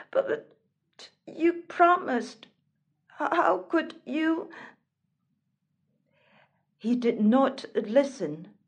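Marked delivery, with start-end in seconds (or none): 0.1-4.5 high, hurt pleading with a stammer; distressed. Acted. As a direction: plead as if betrayed, tripping on the words. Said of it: "distressed, tried to make him stay".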